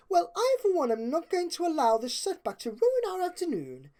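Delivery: high, grand and determined; Rarity declaring. A narrator's reading. Rarity rallying her friends against the rain, declaring. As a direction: announce it like a lady taking charge. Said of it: "Rarity declared".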